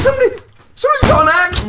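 mock fear. Pretend intruder scare.

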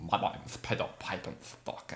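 trying a voice. Acted. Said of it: "I gotta get a voice for him".

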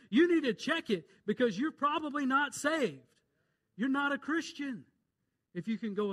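high, loud pulpit warning; full-voiced revival preacher calling out sin; fiery preaching. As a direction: preach it hard at the sinner. Preacher rising to full force.

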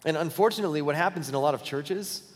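ordinary talk

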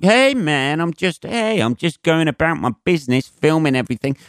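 high, laid-back surfer-type imitation; a hint of a smug American slacker; mock American dude. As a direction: send up the smug guy filming the police. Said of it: "he was like".

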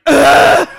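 high, very loud imitated grunt; loud straining groan. Mother imitating her noise as pushing starts, loud straining groan. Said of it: "I made this noise like".

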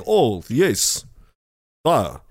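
normal talking voice

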